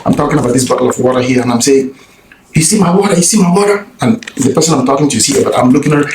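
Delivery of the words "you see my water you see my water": a hammed-up high yelled accusation; demonstrating his yelling. Shown as a demonstration. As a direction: act out the man calling to bystanders.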